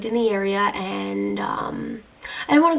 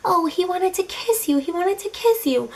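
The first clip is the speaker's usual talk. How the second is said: pitched-up singsong teasing; mocking viewers; 8 semitones higher than usual. Imagining viewers teasing her, a mocking singsong. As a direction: tease like a nosy viewer. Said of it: "what you're all saying to me".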